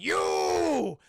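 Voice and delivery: long, high, loud bellow; mock furious yell; accusing shout. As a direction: shout it drawn out like an angry spirit. Spirit confronts Dipper in retelling.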